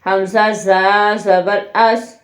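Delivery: ordinary delivery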